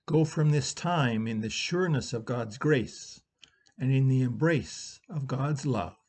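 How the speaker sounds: soft, solemn blessing over the congregation; benediction. Acted. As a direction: send them out gently with a blessing. Spoken benediction.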